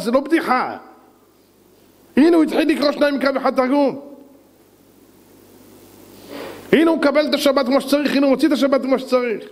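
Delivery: high-pitched, insistent sermon delivery; impassioned, high-voiced exhortation; fervent preaching. Real, not acted. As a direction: pile up each example with rising fervour.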